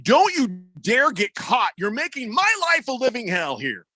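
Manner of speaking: high, exasperated complaining voice; whiny frustrated owner. Retold or imitated. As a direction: whine it like a harried boss. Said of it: "No, it's gonna be".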